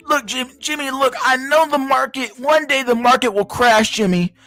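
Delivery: high-pitched mocking elder voice; dying boomer caricature. Plays dying boomer addressing Jimmy.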